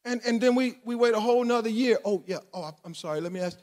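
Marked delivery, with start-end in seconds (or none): 2.0-3.6 low, soft, offhand apology; acted feeble afterthought to God; half-hearted prayer. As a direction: drop to a small, low voice.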